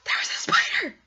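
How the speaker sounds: high, loud blurt; panicked outburst. Retold or imitated. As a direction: yell it at mom over the box. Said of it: "I'm like".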